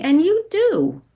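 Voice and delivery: sharp high emphatic do; pointed jab. Acted. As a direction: DO hit high, loud and slow. Mother jabs daughter, high loud.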